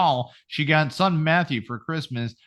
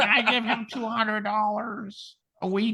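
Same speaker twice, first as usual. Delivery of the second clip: imitating old lady; 7 semitones higher than usual. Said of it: "She's like".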